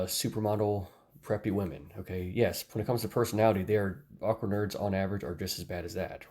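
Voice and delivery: ordinary talk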